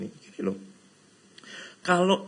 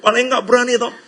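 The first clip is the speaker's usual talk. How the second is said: much higher and louder, fired-up retelling; excited preaching; 10 semitones higher, 11 dB louder than usual. Real, not acted. Preacher whips up the congregation with the eager crowd story, excited and loud.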